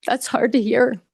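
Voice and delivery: holding back tears, voice tightening; swallowing emotion as the words come out; first catch in the throat, still holding together; choked up. Real, not acted. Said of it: "I'm getting a little choked up".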